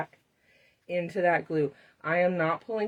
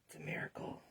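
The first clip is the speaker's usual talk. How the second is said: hushed, breathy aside of wonder; mock awed whisper; 2 semitones lower, 16 dB quieter than usual. Real, not acted. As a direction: whisper it like a small miracle happened. Marker works after gasp.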